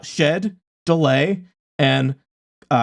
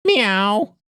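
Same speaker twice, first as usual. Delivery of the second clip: high meowing; cat meow; 5 semitones higher than usual. Acted. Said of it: "The automated voice ... blares".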